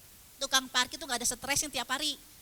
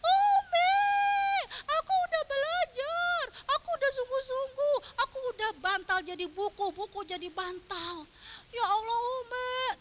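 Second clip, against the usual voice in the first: high, loud mock wail to mum; imitated crying; 13 semitones higher, 6 dB louder than usual. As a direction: wail out each complaint like a bawling child. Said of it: "Dia nangis, nangis-nangisnya".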